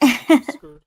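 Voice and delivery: high, loud playful fake shriek; mock scared shriek. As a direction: squeal it in mock fear. Afraid to look at chat.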